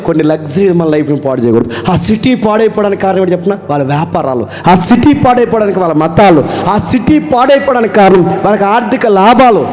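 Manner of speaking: loud, high pulpit declarations; fervent preacher driving the point; preaching at full force. Acted. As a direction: proclaim each line to the crowd.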